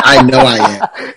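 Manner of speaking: self-mocking laugh through the words. Real, not acted. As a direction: laugh it out as you own up. Admits being self-absorbed, laughing.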